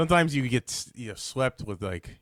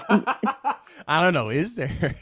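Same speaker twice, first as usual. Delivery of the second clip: laughing through the words; giggling out a coy dodge; 6 semitones higher, 5 dB louder, much slower than usual. Real, not acted. Interviewer asks about tension, answered laughing.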